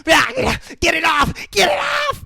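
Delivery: very high panicked shriek; mock screaming. Possessed girl burned by the cross, mock screaming. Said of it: "as she's screaming".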